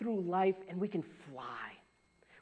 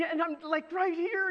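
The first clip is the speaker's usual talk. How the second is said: normal talking voice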